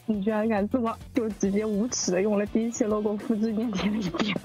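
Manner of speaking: normal talking voice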